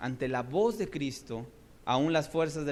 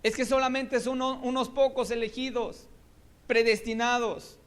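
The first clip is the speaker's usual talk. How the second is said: high, sarcastic voice; mock the idea; 9 semitones higher, 4 dB louder than usual. Preacher mimicking Calvinist claim, raised and scornful. Mocking opposing doctrine.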